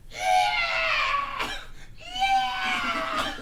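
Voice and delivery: screaming in a high squeal. Acted. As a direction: squeal as you get stepped on. Stepped-on creature screaming.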